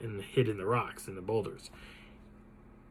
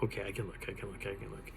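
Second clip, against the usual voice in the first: murmured under the breath, pumping himself up; quiet self-talk; 4 semitones lower, 14 dB quieter, faster than usual. Retold or imitated. Alone and scared, psyching himself up to turn: murmured self-talk. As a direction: talk yourself into it, softly, almost to yourself.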